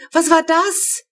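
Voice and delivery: high, loud startled yell; a little girl yelling herself awake; bellowing child. A narrator's reading. Little sister jolted awake by a bang, yelling. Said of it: "brüllt Flo".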